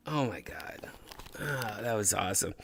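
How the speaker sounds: breathless whispered awe; a soft, amazed whisper; awed whisper. Real, not acted.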